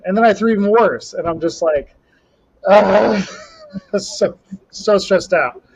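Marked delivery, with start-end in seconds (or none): 2.7-3.3 short, loud, higher cry of frustration; frustrated yell. Retold or imitated. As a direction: let out a pained shout at a failed throw. Third throw even worse.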